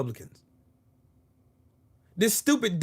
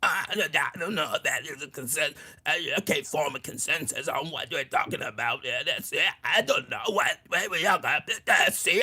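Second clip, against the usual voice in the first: softer, strained-sounding mock politician mumble; mock Robert F. Kennedy; 3 semitones lower, 4 dB quieter than usual. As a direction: mimic a fading, halting voice mockingly. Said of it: "What happened to your voice, bitch?".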